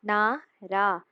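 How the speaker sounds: ordinary talk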